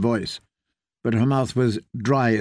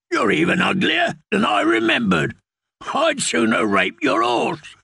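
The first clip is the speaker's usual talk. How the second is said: higher, sneering outlaw voice; mocking taunt; 9 semitones higher than usual. A narrator's reading. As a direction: jeer at her with contempt.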